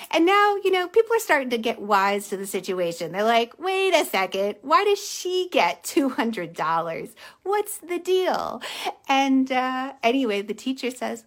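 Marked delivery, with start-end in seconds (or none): 3.6-8.6 mock indignant protest, drawn out; indignant questioning. People getting wise to the hundred-dollar giveaway, indignant. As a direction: slowly demand an explanation, louder. Crowd objects to unfair payout.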